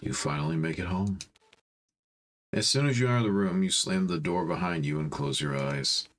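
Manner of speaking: normal talking voice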